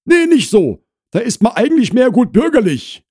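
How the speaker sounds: high, loud housewife voice; Frau Krause explaining. Acted. Wife describes holiday food to neighbour, high and loud. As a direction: answer firmly, pitched high.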